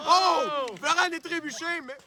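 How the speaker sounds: high-pitched goal shout; excited goal call. Acted. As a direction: burst out at the goal. Goal called in live commentary. (cri de but excité)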